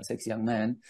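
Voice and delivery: ordinary talk, nothing marked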